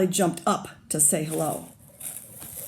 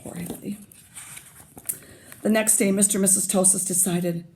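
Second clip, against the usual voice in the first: usual speaking voice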